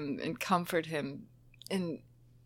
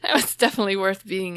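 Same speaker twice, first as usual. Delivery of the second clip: laughing while tearful; 2 semitones higher, 6 dB louder, faster than usual. Real, not acted. Laughing through tears.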